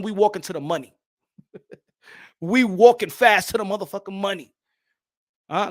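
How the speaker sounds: usual speaking voice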